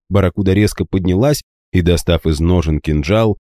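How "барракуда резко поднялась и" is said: plain reading voice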